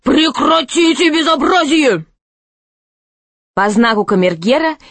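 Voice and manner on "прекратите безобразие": high, put-on royal yell; angry shout. A narrator's reading. Angry king stopping brawling knights, shouting. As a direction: shout an order in exasperation. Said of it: "закричал он".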